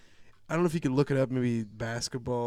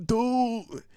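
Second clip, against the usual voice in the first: high surprised dude; 12 semitones higher, 6 dB louder than usual. Retold or imitated. Students reacting.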